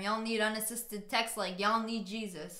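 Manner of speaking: ordinary talk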